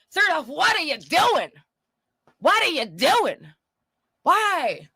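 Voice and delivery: high-pitched exasperated questioning; baffled scolding at a stranger's confession. Real, not acted. Exasperated at the girl in clip.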